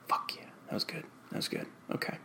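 very soft, under the breath; hushed self-praise. Acted. Performer congratulating himself between audition takes, hushed self-praise. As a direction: whisper it privately to yourself.

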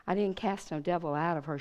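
ordinary talk, nothing marked

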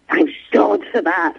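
put-on slow, breathy, deadpan relief from a child; withering. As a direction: sigh it out like an unimpressed nine-year-old. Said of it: "like this withering nine-year-old".

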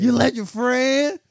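mock outraged accusation. Retold to a friend, a woman's high slow accusing cry. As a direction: drag out the blame in disbelief.

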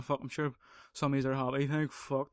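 ordinary delivery

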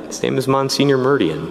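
normal talking voice

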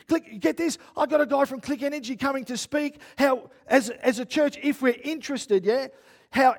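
loud, high, frantic rambling; acted-out panic. Preacher relives realising he double-booked the meeting, panicked. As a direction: replay a meltdown, tumbling from one worry to the next. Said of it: "I'm like, I'm having this moment".

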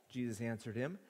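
usual speaking voice

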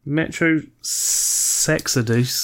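slow, drawn-out cheeky pun; slow suggestive pun. Pretend. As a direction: stretch the joke out slyly. Pun on the game title.

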